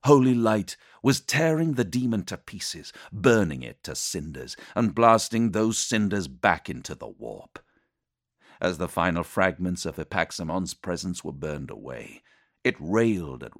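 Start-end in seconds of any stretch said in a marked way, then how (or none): none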